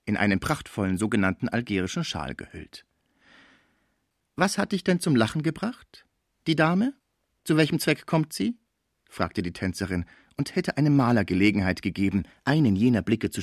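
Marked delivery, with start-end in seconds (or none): 4.4-8.6 high-voiced dancer asking questions; dancer, brisk and curious. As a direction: play the inquisitive dancer, pitched high. (neugierige Tänzerin, hohe Stimme)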